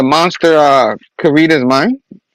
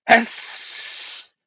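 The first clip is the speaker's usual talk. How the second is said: long voiceless hiss; vampire hiss; 7 semitones higher, 6 dB quieter than usual. Retold or imitated. Vampire rabbit bursts out of nowhere, long imitated hiss.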